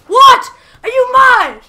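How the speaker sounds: high, loud outraged yelling; outraged shout. Real, not acted. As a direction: yell in outrage. Shocked by opponent's hit.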